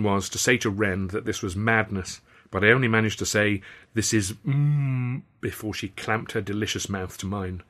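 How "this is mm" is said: drawn-out words smothered into a hum; slow, muffled trailing hum; cut off by a kiss. A narrator's reading. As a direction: start to speak, then get kissed mid-word.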